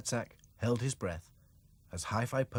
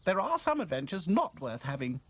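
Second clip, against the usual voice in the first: put-on high girl doll voice; 9 semitones higher, 4 dB louder than usual. A narrator's reading. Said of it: "agreed Dotty".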